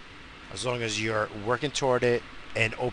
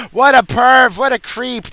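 very high, loud laughing self-mockery; giggling mock disgust; laughing at himself; 14 semitones higher, 11 dB louder than usual. Real, not acted. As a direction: squeal it through a laugh. Mocking himself as a perv.

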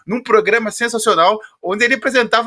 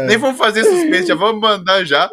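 usual speaking voice

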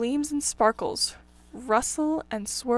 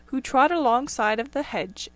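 ordinary delivery, nothing marked

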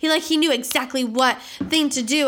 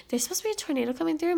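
retold worried report; 5 semitones higher, 6 dB quieter, faster than usual. Retelling telling roommate about tornado.

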